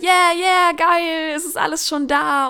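hyped-up quote of an excited convert; thrilled cheering. Retold or imitated. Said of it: "sie ist so begeistert davon, sagt".

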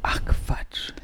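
breathy whisper of disbelief. Real, not acted. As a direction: hush it out, unvoiced.

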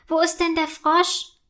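startled. A narrator's reading. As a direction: ask with a touch of alarm. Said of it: "fragt Mia erschrocken". (leicht erschrocken)

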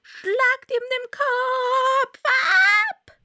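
very high, slow furious scream. A narrator's reading. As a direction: shriek the execution order. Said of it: "zu zanken und zu schreien".

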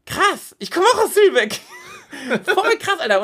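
high, laughing, squeaky disbelief; young guy cracking up in a high squeak; amazed laughing voice. Retold or imitated. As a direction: act out a stunned stranger who can't stop laughing. Stranger amazed, same village.

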